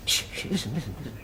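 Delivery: whispery, low and soft; hushed shushing. Acted. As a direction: hush your mate to eavesdrop. Eavesdropping, hushing his mate.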